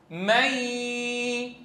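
"مي" stretched into a long nasal-held y; merged noon. Shown as a demonstration. Teacher has students look at what noon became, prolonged ghunna. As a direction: hold the y of "مي" long, humming through the nose. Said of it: "شوفوها إيش؟".

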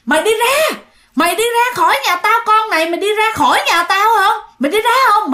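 high, loud furious yelling; angry shout. Acted. Grandma swinging the broom, yelling at the ghost.

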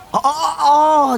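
a long, high, slow "oh, oh, oh" as it dawns on him; high, drawn-out oh of realization. Acted. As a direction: stretch out the sudden remembering. Suddenly remembers the loot.

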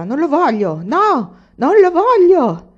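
high, loud shout of refusal; furious-sounding shout. A narrator's reading. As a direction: shout it out, climbing to a drawn-out peak. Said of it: "il mugnaio gridava".

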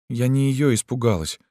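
put-on high, girlish confession; frightened girl. A narrator's reading. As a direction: confide in a small high voice. Girl figure confiding fear.

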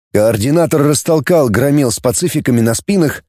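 plain reading voice

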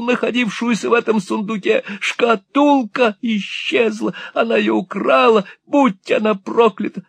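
put-on a robbed old man shrieking in panic; frantic old miser. A narrator's reading. Old miser raves about theft.

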